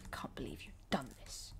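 hushed, breathy mock disbelief; mock whisper. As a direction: whisper it like a little drama. Gamer mock whisper to game.

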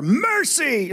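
high, agitated cry. Acted. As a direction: call it out high, insisting.

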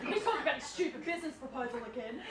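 low, soft grumble; disdainful aside. Acted. Hostess complains to Janet about brother's proposal, disdainful aside.

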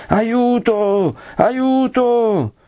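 high, drawn-out cry for help; Don Abbondio's wild cry. A narrator's reading. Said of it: "quel primo sgangherato grido di Don Abbondio". (grido sgangherato, acuto d'aiuto)

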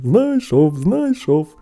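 put-on proud boyish call; boastful exclamation. A narrator's reading. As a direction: brag to your brothers. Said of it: "знов похвалився братам".

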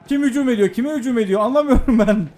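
high, agitated questioning; agitated confusion. Real, not acted. Confused under attack in game.

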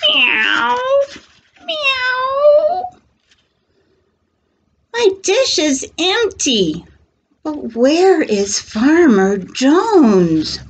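a hungry kitten wondering aloud; cat voice. Acted. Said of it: "The cat rubs against a post".

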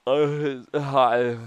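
talking through a yawn. Real, not acted. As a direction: stretch the words out in a wide yawn. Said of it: "sorry, I was yawning".

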